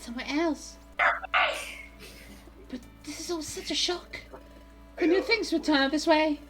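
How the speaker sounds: bewildered game character. Acted. Hero checking on Jimmy after the attack, bewildered.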